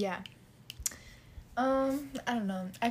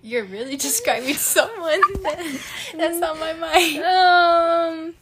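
loud, high, breaking into laughter; helpless laughter through the words; laughing while talking; 7 semitones higher, 13 dB louder, slower than usual. Real, not acted. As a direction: crack up as you tease a friend. Teasing friend about someone on mind.

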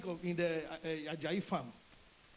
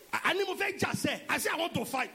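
much higher, loud mock shout; angry fighting talk; 9 semitones higher, 5 dB louder than usual. Angry husband insisting he wants to fight, loud shout. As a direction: fire it out like a man spoiling for a fight.

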